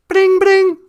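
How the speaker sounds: high, loud sung ringtone; mock telephone ring, high and loud; phone ring imitation. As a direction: chirp it out like a ringing phone. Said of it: "is that the phone?".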